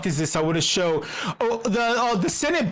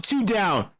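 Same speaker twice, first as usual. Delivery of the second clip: ordinary delivery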